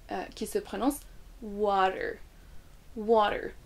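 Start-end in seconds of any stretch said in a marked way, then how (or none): 1.4-3.5 WATER in American, "WAH-der", first syllable long; American accent. Shown as a demonstration. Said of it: "Ça c'est l'accent américain".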